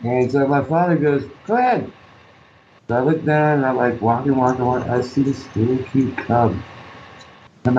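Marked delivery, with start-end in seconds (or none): 1.4-1.9 much higher prompting voice; father's prompt. Retold or imitated. Dad nudging his son to look ahead, high.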